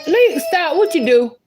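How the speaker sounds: high sharp call to stop. Real, not acted. Mother calling to little Lee to stop. Calling to a child mid-story.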